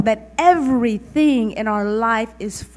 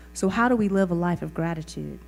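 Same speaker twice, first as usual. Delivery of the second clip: usual speaking voice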